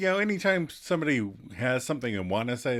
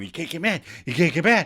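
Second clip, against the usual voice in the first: put-on higher-pitched childish taunt; lightly imitated toddler teasing; 5 semitones higher, faster than usual. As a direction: chant it like a needling little kid. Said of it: "You know, like a toddler".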